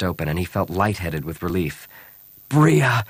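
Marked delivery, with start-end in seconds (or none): 2.5-3.0 short, higher-pitched yell. A narrator's reading. Han grabbing Bria in relief, yelling. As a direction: call her name out loud. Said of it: "he yelled".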